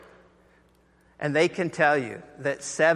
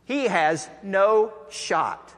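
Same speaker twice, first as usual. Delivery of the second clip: slow emphasis; 4 semitones higher, much slower than usual. Real, not acted. Preacher stressing hopelessness.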